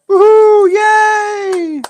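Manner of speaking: high, loud whoop of celebration; excited cheer. Real, not acted. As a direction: cheer the winner like a crowd. Celebrating a giveaway winner live.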